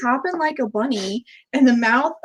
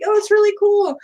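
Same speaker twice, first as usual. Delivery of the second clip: enthusiastic; 10 semitones higher, 4 dB louder than usual. Retold or imitated. Retold polite reply to girl.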